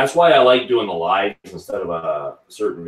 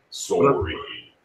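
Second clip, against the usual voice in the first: deep drawn-out Canadian sorry; Canadian accent; 8 semitones lower, 4 dB quieter than usual. Retold or imitated. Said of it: "making fun of a Canadian accent".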